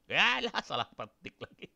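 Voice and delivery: high yelp breaking into laughter; amused, high-pitched exclamation dropping into a chuckle; laughing at own slip. Real, not acted. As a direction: laugh off a silly typing mistake.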